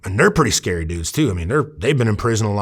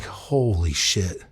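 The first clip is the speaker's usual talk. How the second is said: stunned; 3 semitones lower, 6 dB quieter, much slower than usual. Retold or imitated. Retold awe at scary man.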